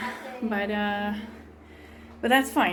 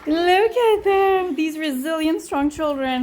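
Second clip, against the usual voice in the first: high, slow, adoring praise; proud doting; 6 semitones higher, 7 dB louder, slower than usual. Real, not acted. Praising children to the camera.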